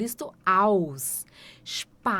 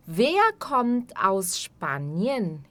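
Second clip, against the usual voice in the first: slow model; much slower than usual. Shown as a demonstration. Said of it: "teacher models question".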